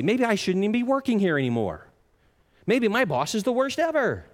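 high, hurried mock grumbling; angry, disappointed. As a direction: act out a disgruntled employee's rising complaint. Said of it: "we get angry, we're disappointed".

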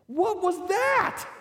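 very high, loud, slow amazed question; astonished. Retold or imitated. Husband asking his wife in amazement after she scared the dog. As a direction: draw it out in stunned disbelief.

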